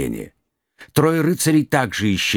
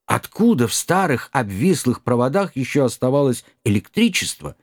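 usual speaking voice

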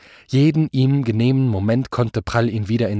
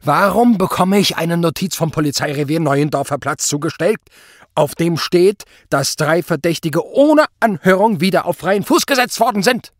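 raised, loud, indignant demand; blustering anger; 7 semitones higher, 5 dB louder than usual. A narrator's reading. Superior demands an explanation from Zott, blustering. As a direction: build higher towards an outraged question. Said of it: "polterte Obergruppenführer Prall".

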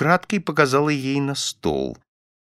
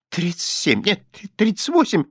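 high, confused, hesitant answer; intimidated fumbling; 7 semitones higher than usual. A narrator's reading. Frightened wife muddling the sum, flustered. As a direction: grope for the answer, pitched up. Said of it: "начала путаться запуганная миссис Корнер".

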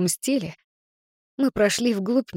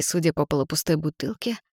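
usual speaking voice

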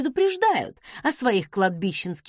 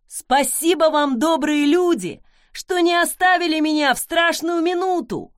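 high, loud, melodramatic thanks; pathetic gratitude; 6 semitones higher, 5 dB louder than usual. A narrator's reading. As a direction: overdo the victim's heartfelt thanks, pitched up. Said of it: "патетично поблагодарила жертва".

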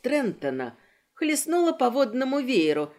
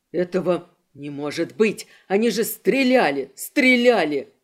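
rising, louder frantic protest; desperate disbelief; 4 semitones lower, 5 dB louder than usual. Acted. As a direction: shout it in denial. Kristof refuses to believe.

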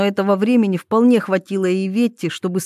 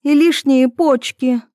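higher, louder slow threat; angry threat; 5 semitones higher, 5 dB louder than usual. A narrator's reading. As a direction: spit the threat out angrily. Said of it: "Голос Иветы звенел от гнева и ярости". (гневная угроза)

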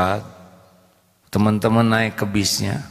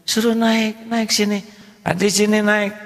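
put-on much higher, eager mother inviting the boy in; kind mother; 11 semitones higher than usual. Mother calls the boy into her car, high and friendly. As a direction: chirp it up high like a friendly mom calling a kid over. Preacher voices the rich mother.

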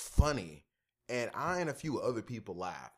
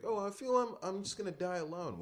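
whiny; 7 semitones higher than usual. Retold or imitated. Whiny acted victim.

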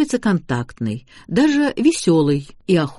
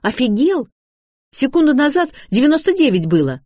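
high, loud indignation; возмущённый протест; 9 semitones higher, 4 dB louder than usual. A narrator's reading. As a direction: protest the price in a raised, high voice. Шарлотта возмущена выросшей ценой. (громкое возмущение высоким голосом)

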